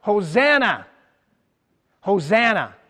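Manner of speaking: mock crowd cry, lifted. Preacher voicing the crowd crying out to Jesus, mild call. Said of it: "they were crying out".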